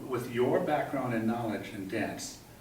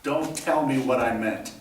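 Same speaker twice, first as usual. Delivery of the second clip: angry retort; 7 dB louder than usual. Real, not acted. Actor repeats retort at director.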